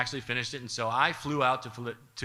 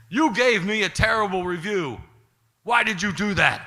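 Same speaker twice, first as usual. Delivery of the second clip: imitating an aggrieved, raised-voice complaint; higher, hurt and angry, acted out; upset accusation; 4 semitones higher, 7 dB louder than usual. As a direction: loudly demand an explanation. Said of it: "Paulie really did not like that".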